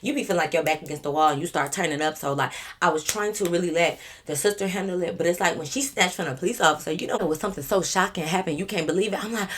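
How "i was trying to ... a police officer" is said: normal talking voice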